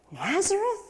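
high, rising question of scorn; mock disbelief. Townspeople snicker at Jesus's hometown, mock disbelief. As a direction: scoff at the town's name. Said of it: "people would snicker".

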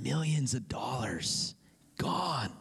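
whispered in awe. Real, not acted. Preacher marvelling at the forgiven debt to the congregation, whispered. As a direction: drop to a whisper on the sum.

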